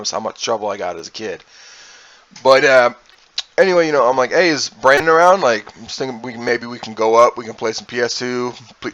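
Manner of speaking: ordinary delivery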